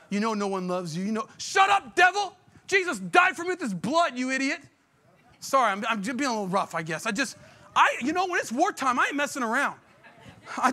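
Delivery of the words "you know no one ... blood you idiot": shouting down the devil. Pretend. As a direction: yell it right in his face. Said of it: "Give him the word of God right in his face".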